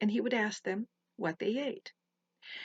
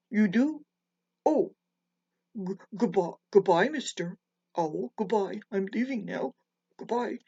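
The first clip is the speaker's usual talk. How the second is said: frightened frog; 5 dB louder than usual. A narrator's reading. Frightened frog flees the owl.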